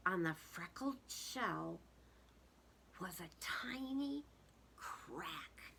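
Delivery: whispered, hushed reveal; hushed suspense. A narrator's reading. Narrator hushes children as the shell cracks. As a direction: draw listeners in with a hush.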